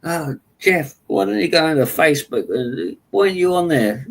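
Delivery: high, nagging imitation of a coworker; mocking coworker. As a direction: whine it high like a pestering colleague. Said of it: "He used to".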